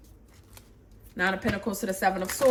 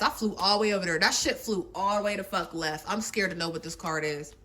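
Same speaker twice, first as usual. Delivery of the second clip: normal talking voice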